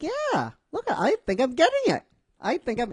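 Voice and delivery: high, excited boast, pleased with himself; proud Naruto. Acted. Naruto boasting to Ino, high and excited. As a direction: show off like a kid who just got it right.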